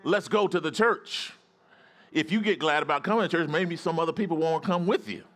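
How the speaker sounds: high, driving sermon exhortation; fired-up preaching. Real, not acted. Pastor rallying congregation to go to church, fired-up preaching.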